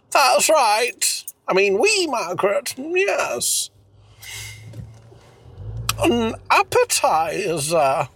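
put-on high hammy French accent; crooning mock French lover; fake foreign language. Mock French couple role-play.

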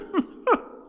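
short high, loud cackle; acted mirthful laugh. Nephew laughing at Scrooge's belief, high loud laugh. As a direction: burst out laughing at the top of the voice.

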